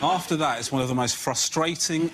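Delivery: ordinary delivery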